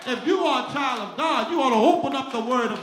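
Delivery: high, loud, sung-out preacher's climax; rising, chanting sermon cry of joy; preaching at full force. Acted.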